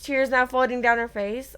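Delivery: plain reading voice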